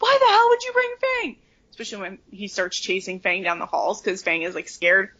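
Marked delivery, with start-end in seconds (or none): 0.0-1.4 high, loud incredulous cry; exasperated outburst. Retold or imitated. Shouting at Hagrid about bringing Fang, high and incredulous. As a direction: yell it in disbelief. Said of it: "I was like".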